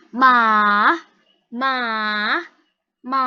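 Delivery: "ma" drawn out, down then up; dipping third tone. Shown as a demonstration. Tutor shows student tone three, falling then rising. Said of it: "nada tiga ini turun naik".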